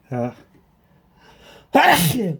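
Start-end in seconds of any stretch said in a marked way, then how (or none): 1.7-2.4 loud high sneeze; sneezing. Real, not acted. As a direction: let out a big sudden sneeze. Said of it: "to sneeze".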